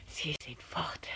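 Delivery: leise, tonlos nach Luft japsend; erschöpft flüstern, mit hörbarem Atem; jappend. A narrator's reading. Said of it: "jappte er". (atemloses Flüstern)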